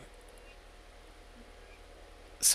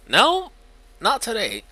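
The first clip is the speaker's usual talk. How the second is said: defiant; 12 semitones higher, 9 dB louder than usual. Acted. Defiant reply.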